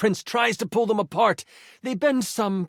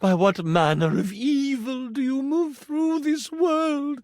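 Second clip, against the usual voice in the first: frightened queen, much higher; 10 semitones higher than usual. Acted. Queen pleads with husband.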